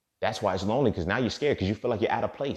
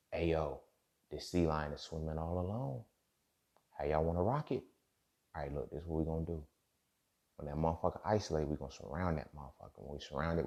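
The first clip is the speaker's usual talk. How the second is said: conspiratorial orca; 2 semitones lower, 6 dB quieter than usual. Retold or imitated. Orca briefing its team on the hunt, hushed and scheming. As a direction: whisper the plan to the pack.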